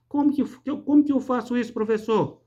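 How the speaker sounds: um leve aluno perguntando em tom mais agudo. Pretend. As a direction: imitar de leve o aluno que pergunta ao professor. Voicing a pupil's question. (aluno em dúvida, com voz um pouco mais aguda)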